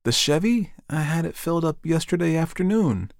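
high-pitched, bewildered wife; dazed Janet. Acted. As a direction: answer in a high daze.

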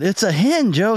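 high, insistent call to a hunting partner; calling out warning. Acted. Friend warns hard-of-hearing grandpa while hunting, calling out high. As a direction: pitch it up to reach deaf ears.

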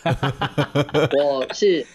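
put-on self-introduction in Japanese-accented Mandarin. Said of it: "这个日本腔也是OK".

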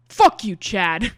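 loud, drawn-out angry shout; furious cutoff. Acted. Brad cutting Chad off, angry shout. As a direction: cut him off with a slow, forceful yell. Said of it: "cuts him off immediately".

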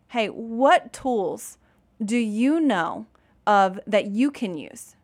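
slow, drawn-out gentle question; slow, patient prompt. Retold or imitated. Mom coaxing her upset son to name tools, slow and patient. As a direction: stretch each word out, giving him time. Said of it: "I'll sometimes literally say".

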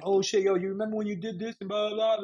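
hyped-up retelling, rattling into blah blah; imitating excited reminder. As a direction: imitate someone eagerly dredging up an old story. Imitating people bringing up the past.

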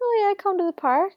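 high, fast mocking tone; mock a clueless gangster. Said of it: "mocking Desmond's attitude".

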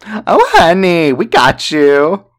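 high, slow, loud sing-song oh honey; hammed-up mocking. Drunk man taunting her in a hammed-up mocking voice. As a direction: camp it up as a taunt.

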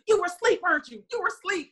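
high, knowing teasing as the husband. Retold or imitated. Husband teasing his wife for sleeping, high and knowing.

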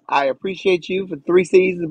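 ordinary talk

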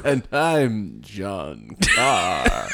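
slow horror host with laugh; sinister horror movie host; Vincent Price voice. Pretend. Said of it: "almost Vincent Price-ish".

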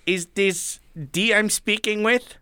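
lightly voiced caller asking politely; a hint of a puzzled phone agent; mock salesman. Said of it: "he goes".

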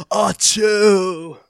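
loud violent sneeze; sneezing. Retold or imitated. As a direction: sneeze like Nicolas Cage. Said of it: "like, Nicolas Cage sneezes".

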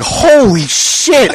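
high, very slow, stretched-out "Holy shit"; drawn-out shocked exclamation. Retold or imitated. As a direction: stretch it out high in mock horror. Retold shock at own fart.

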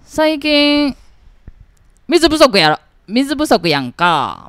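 loud, high Kansai uncle lecturing; strong Kansai dialect. Acted. Kansai woman mimicking a local uncle over Tainan's water shortage, strong Kansai dialect. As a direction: play a gruff Kansai uncle griping about the water shortage. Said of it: "你在讲这个腔的时候，你的表情就跟刚才不太一样了".

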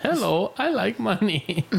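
high, slow silly voice; high cartoon voice. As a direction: squeak it out slowly. Kid mimics Mr. Krabs line.

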